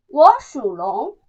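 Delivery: normal talking voice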